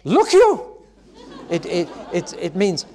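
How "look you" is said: high, loud Welsh cry of wonder; Welsh exclamation. Acted. Preacher giving the angel's cry in Welsh, loud and astonished. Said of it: "In Welsh".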